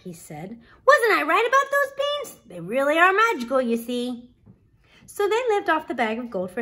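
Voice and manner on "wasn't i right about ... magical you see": very high excited opening, settling at the end; triumphant Jack. Acted. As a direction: boast to mother as a proud boy. Jack proves the beans magical.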